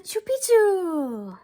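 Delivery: loud drawn-out sneeze; sneezing the secret word into his ear. Acted. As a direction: sneeze the password out. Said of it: "half sneezed, half spoke".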